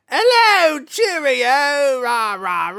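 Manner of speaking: deliberately over-the-top bad British accent; terrible British accent. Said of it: "terrible British accent".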